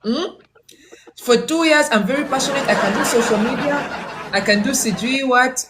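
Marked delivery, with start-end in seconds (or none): 1.2-5.7 higher, laughing imitation of an eager candidate; mock the keen applicant through laughter; host imitating Joy's passionate pitch, laughing and higher; laughing mockery.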